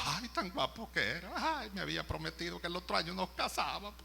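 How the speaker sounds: hammed-up tearful whine; mock weeping. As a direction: wail like a bereft bride, played up. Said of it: "llorando a moco tendido".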